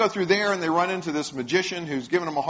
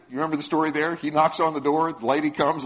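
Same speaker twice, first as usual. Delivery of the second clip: normal talking voice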